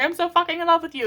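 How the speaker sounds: gushing fan. Retold or imitated. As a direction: squeal it like a smitten fan. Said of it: "being like".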